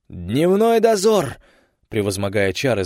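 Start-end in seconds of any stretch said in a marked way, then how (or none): 0.1-1.4 loud high slow yell; shout. Acted. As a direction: bellow the name to break the spell. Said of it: "заорал начальник бригады".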